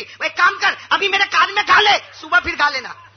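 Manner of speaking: loud, high, worn-out teacher giving in; tired teacher tells the child to sing in her ear; fed-up teacher. Retold or imitated. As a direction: throw up your hands and give in. Exhausted teacher gives in.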